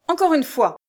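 high, loud warning; menacing anger. A narrator's reading. Abductor warns the newcomer, menacingly angry. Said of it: "avec une colère menaçante".

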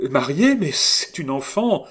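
high, loud incredulous protest; astonished cry. A narrator's reading. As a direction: exclaim in shocked disbelief. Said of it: "s'écria-t-elle".

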